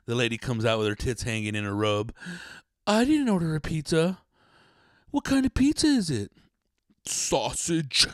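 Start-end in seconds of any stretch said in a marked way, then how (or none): 2.9-6.3 high breathy hammed-up porn actress voice; mock porn lady. Housewife in the porno answering the pizza guy, hammed-up high coo. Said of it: "same old story". 7.1-8.1 a bit higher, smug porn delivery line; mock pizza guy. Pizza guy answering the lady in the porno, smug cheesy line. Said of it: "same old story".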